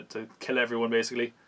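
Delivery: usual speaking voice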